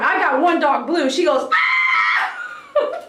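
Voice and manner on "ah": piercing high shouted scream, much higher; imitated dog scream. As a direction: scream like Blue at top pitch. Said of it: "at the highest pitch of her voice".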